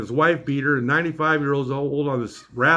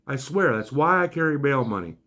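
ordinary talk, nothing marked

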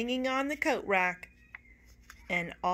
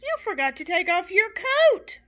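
put-on loud child; 11 semitones higher, 9 dB louder than usual. A narrator's reading. Joey continues his call.

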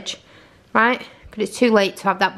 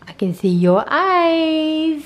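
playful tease; 6 semitones higher, much slower than usual. Real, not acted. Teasing toddler.

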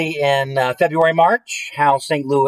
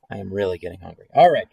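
ordinary delivery

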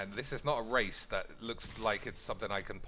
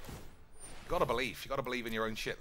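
usual speaking voice

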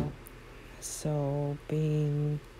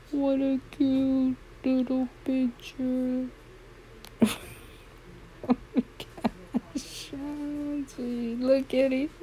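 cutesy squeal; 9 semitones higher than usual. Real, not acted. Gushing at the cute foal family photo, high squeal. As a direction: gush over the picture in a tiny squeaky voice.

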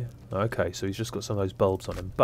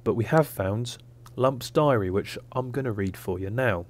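normal talking voice

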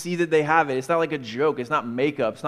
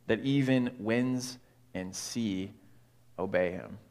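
measured Bible reading voice, unhurried; scripture reading. Acted. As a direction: read the passage like a storyteller in the pulpit. Reads the gospel passage aloud.

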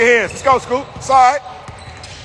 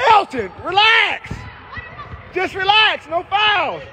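slow, high call to settle him; slow soothing shout across the gym; calling to calm; 6 semitones higher, slower than usual. Real, not acted. Calming a fouling player.

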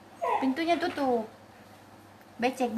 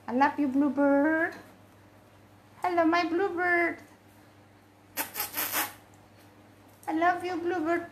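high, sing-song pet-talk; affectionate cooing; 4 semitones higher than usual. Real, not acted. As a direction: coo lovingly to your bird. (sapaan sayang bernada tinggi)

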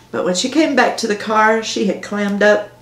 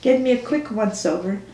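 ordinary delivery, nothing marked

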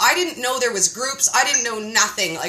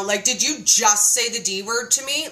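ordinary delivery

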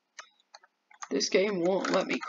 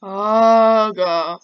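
loud, drawn-out oh; alarmed cry; 10 dB louder than usual. Real, not acted. Player caught out in the game, alarmed cry. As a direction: cry out long, then drop on God.

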